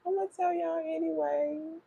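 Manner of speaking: high, playful sing-song tease; teasing. Pretend. Teasing her live viewers with gossip, playful high sing-song. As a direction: tease them that the tea is coming anyway. Teasing repeat after laugh.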